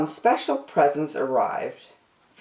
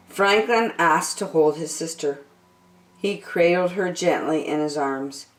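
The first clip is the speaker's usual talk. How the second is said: plain reading voice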